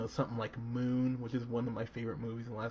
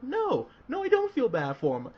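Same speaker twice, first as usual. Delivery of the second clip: emphatic refusal; 12 semitones higher, 6 dB louder than usual. Retold or imitated. Emphatic inner reaction quoted.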